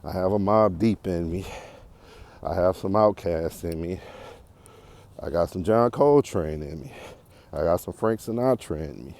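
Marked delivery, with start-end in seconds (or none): none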